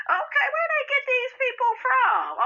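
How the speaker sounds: outraged high wail; disbelieving squeal. Real, not acted. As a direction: wail it out, can't believe it. Outraged at a mother abandoning her child.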